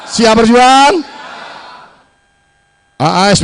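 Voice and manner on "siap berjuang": louder rising call to crowd; rally shout. Real, not acted. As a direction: push it harder the second time.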